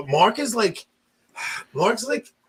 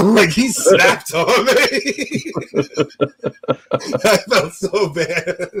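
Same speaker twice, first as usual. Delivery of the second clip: talking through real laughter; slow, laughing confession; much slower than usual. Real, not acted. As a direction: crack up while telling it, words drawn out. Laughing at own retold story.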